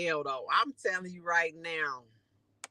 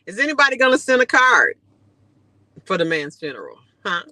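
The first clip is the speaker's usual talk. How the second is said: loud, fast, teasing; loud fast mock-serious questioning; teasing loud questions; 10 dB louder than usual. Real, not acted. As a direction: rib the viewers loudly.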